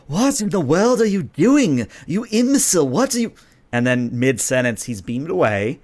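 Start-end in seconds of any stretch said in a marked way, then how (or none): 0.1-3.3 high, loud-edged outraged prince; indignant prince. Acted. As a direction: sputter in outrage at disobedient androids. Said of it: "He's like".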